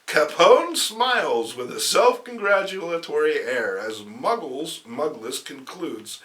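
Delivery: ordinary talk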